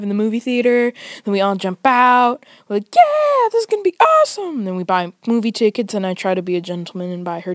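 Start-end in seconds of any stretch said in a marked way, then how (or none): 2.9-4.6 high, quick excited cheer; hyped cheer. Retold or imitated. Friends arriving at the theater, high excited cheer. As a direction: shoot the voice way up with glee.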